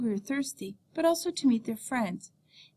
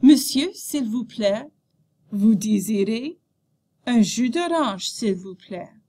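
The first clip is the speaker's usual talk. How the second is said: acted cafe dialogue; 7 dB louder than usual. Shown as a demonstration. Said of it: "Act out the dialogue".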